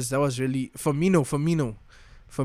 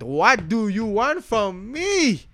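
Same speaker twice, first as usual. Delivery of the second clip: high, slow, pleading [name] voice; hammed-up [name] plea; [name] impression; 7 semitones higher, much slower than usual. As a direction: stretch it out like [name] begging. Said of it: "[name] voice".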